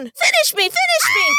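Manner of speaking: very high screamed begging; screamed plea. Acted. Tree begs to be finished off, high mock-agonized shriek. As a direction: shriek it as a tortured victim.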